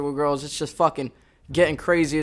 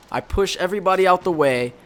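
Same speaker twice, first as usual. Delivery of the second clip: ordinary delivery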